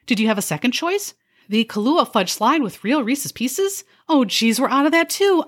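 high, chirpy server voice with a snide edge; a fake-cheerful waitress needling her customers; perky sarcastic waitress. A waitress sweetly insulting her diners, chirpy and high.

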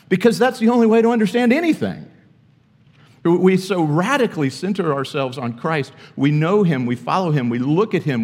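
full-force preacher building on Christ; impassioned preaching. Acted. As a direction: drive it home from the pulpit.

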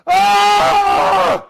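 sudden high yell of frustration; frustrated scream. Retold or imitated. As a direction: let out a furious shriek. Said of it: "and I'm going".

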